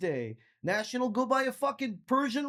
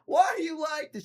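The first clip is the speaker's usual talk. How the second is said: mock hysterical; 8 semitones higher than usual. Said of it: "She got all bad".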